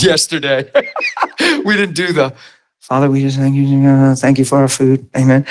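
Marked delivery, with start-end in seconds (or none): 2.8-5.4 rote, monotone grace before a meal; religious prayer. Retold or imitated. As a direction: drone out a dutiful mealtime prayer. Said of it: "It's not a religious prayer".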